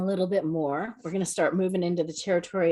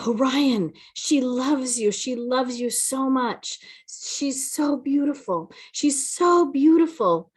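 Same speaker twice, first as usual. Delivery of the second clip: awed, higher, slow and halting; shaken and stuttering; 6 semitones higher than usual. Retold or imitated. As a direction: pass on the message still shaking from the sight. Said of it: "she's stuttering".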